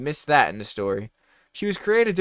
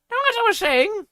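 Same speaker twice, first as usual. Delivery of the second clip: high, loud mocking teacher; stern teacher; 6 dB louder than usual. A narrator's reading. Teacher scolding a late student, high mocking voice. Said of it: "said Miss Banger".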